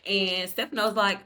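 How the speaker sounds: usual speaking voice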